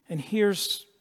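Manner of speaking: normal talking voice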